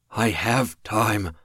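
slow strained words; strained, slow insistence. Acted. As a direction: push each word out. Dying man insists.

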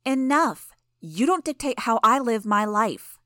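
high, loud furious shout; angry shout. Acted. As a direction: snap back at him. Imp wheels on Gru, jabbing.

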